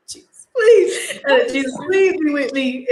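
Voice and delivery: high, laughing near-cry; mock desperate plea. Student praying as dad hears of trouble, mock-tearful pleading.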